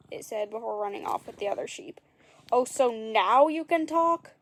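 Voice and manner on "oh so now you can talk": slow, loud indignant call; louder, slower outraged call; cried after. A narrator's reading. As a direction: call it after the fleeing sheep. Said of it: "Jack cried after it".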